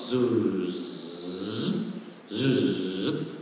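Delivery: long low hissing surf sound; imitated wave whoosh. Shown as a demonstration. Storyteller imitating waves on the beach, hissed whoosh. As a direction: swell and fade like breaking waves. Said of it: "melihat dia tentang ombak".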